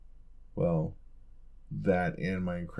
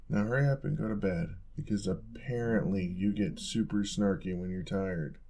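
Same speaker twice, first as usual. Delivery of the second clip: ordinary talk, nothing marked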